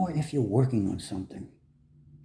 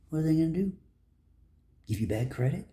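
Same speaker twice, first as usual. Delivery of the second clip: ordinary talk, nothing marked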